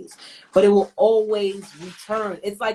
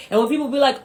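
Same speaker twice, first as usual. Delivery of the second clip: ordinary delivery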